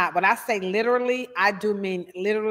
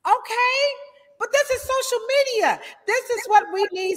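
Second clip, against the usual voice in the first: high, laughing oh I know; light up with recognition; giddy and pitched well up; excited recognition; 13 semitones higher than usual. Real, not acted. Recognises Brett Favre, laughing.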